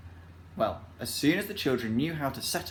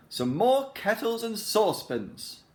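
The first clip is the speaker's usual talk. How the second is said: higher, slower, louder happy request; Saucepan wish; 10 semitones higher, 4 dB louder, slower than usual. A narrator's reading. Saucepan telling Joe what he wants, high and slow. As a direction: ask at once, delighted.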